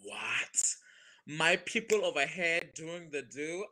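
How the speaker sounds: thrilled retold double take; excited disbelief. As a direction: gasp with joy at finding your people. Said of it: "I was like".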